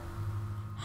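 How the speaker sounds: real soft gasp; sharp breath of dismay; shocked gasp. Real, not acted. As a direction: gasp at an awful request. Gasp at the game's request.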